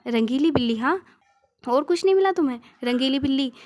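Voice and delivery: loud, annoyed wife; annoyed retort. Acted. Wife scolds Shri for his silly excuse, loud and annoyed. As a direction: throw the word back at him, irritated.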